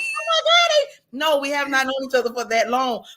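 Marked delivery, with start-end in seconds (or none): 0.0-1.2 high squeal of surprise, much higher; mock amazed gasp. Onlookers imagined gasping at the two friends' closeness, high squeal. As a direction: play an astonished onlooker. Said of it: "They probably like".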